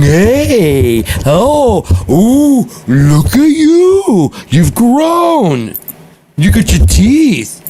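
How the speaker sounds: put-on very high, loud baby talk, drawn out slowly; cooing at a baby. Relatives gushing at the newborn, high slow baby talk.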